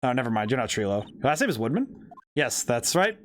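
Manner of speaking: normal talking voice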